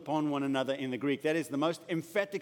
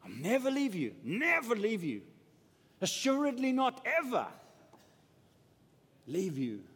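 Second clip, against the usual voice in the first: voice of the Lord; 5 semitones higher than usual. Retold or imitated. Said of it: "the voice of the Lord".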